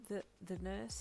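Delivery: soft, halting start. A narrator's reading. Hawkes breaking hard news to the patient, softly. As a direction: say it softly, stumbling on the first word. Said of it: "he said softly".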